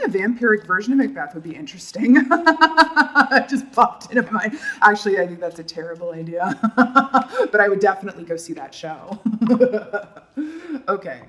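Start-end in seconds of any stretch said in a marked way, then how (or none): 2.2-3.3 high, loud burst of laughter; amused laugh. Real, not acted. Amused by own vampire idea. 6.6-7.5 high, bubbling laugh; amused laugh. Real, not acted. Laughs at terrible idea. 9.2-10.0 short run of chuckles; amused chuckle. Real, not acted. Amused at imagined show.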